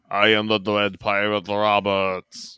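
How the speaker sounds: a lightly voiced dim giant; Andre the Giant. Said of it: "my Andre the Giant impression".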